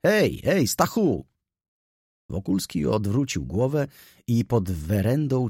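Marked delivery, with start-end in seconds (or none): 0.1-1.2 high, hailing call; bright-pitched "hey there" hail; calling out to a friend. Acted. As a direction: call after a friend to catch his attention.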